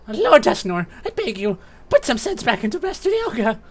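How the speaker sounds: high-pitched minion begging urgently; pleading Sableye. Acted. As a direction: beg your lord in a high creature voice.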